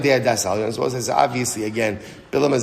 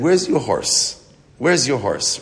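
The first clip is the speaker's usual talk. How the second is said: ordinary talk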